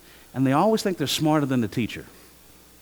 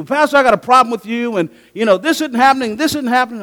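loud, high accusing congregant; hammed-up member coming at the pastor; mock angry complaint; 9 semitones higher, 8 dB louder than usual. Church member confronting the pastor, loud and high mock anger.

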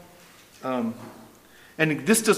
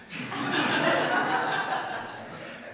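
laughing. Real, not acted. Laughs at the story.

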